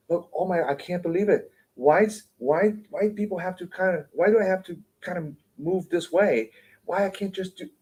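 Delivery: high-pitched mock exasperation; mock complaining. People facing life's trials, imitated high-pitched complaining. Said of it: "we complain and we struggle and we yell".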